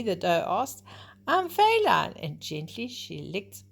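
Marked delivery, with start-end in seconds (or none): 1.3-2.1 high, bright, teasing; playful reveal. Acted. Doe tells Bambi who she is, playful. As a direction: tell him who you are with a smile.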